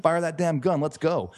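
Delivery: high, eager shout to start the race; can't wait, fire it now; impatient hype. Retold or imitated. Runner at the start line urging the starter on, hyped.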